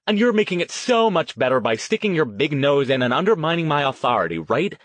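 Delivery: high, angry sarcasm easing down; sarcastic retort. A narrator's reading. Petrucci accusing Duncan of undermining her, sarcastic retort. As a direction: hit back at him with biting sarcasm.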